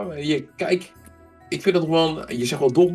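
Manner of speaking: normal talking voice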